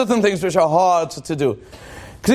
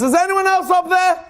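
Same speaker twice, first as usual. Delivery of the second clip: calling upward; 10 semitones higher than usual. Retold or imitated. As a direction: call past the first answer for someone else. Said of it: "he looks up again and says".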